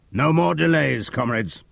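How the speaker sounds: loud, high call to action; rally them with a cry; cried out. Acted. Napoleon crying out to the animals. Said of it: "cried Napoleon".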